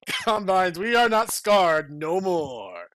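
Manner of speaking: loud, slow defiant cry; defiant hero. Acted. Henry Freeman defies the Combines, loud and slow. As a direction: hurl it at the enemy. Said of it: "said Henry Freeman".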